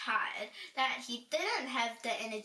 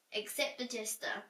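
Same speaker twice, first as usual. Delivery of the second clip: ordinary delivery, nothing marked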